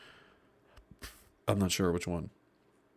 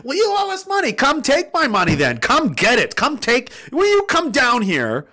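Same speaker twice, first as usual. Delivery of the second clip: defiant taunt; 10 semitones higher than usual. Acted. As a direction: dare them to come collect. Defiant quote to creditors.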